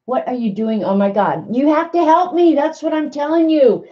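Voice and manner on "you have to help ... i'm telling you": high, flustered plea for help; nervous plea. Real, not acted. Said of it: "now I'm getting all nervous".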